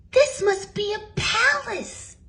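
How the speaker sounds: amazed small bear voice; gasping in awe. A narrator's reading. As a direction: breathe it out in amazement. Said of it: "Corduroy gasped".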